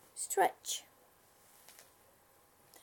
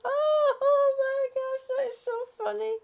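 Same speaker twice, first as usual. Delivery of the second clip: laughing out loud; 11 semitones higher, 5 dB louder than usual. Real, not acted. Bursts out laughing at video.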